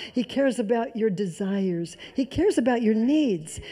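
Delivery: normal talking voice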